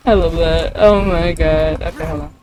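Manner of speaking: normal talking voice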